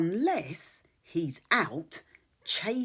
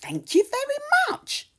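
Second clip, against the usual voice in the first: high, quick grateful kitten; Max the kitten; 5 dB louder, faster than usual. Acted. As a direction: rattle off thanks like an eager kitten. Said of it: "says Max".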